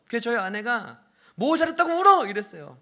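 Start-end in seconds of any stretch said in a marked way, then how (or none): 1.4-2.2 wife's loud, high-pitched scolding; imitated scolding. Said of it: "막 혼내가지고". (아내가 우는 아이를 혼내는 큰 소리 흉내)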